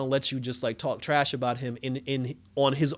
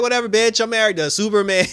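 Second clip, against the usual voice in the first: louder, higher sassy comeback; sassy retort; 7 semitones higher, 9 dB louder than usual. Retold or imitated. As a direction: fire it back with full sass. Lois snaps back at coworker.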